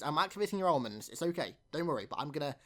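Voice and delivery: ordinary delivery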